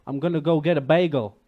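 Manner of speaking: loud New Yorker heading for a bagel; New York accent. Shown as a demonstration. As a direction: belt it like a brash New Yorker. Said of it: "This is New York".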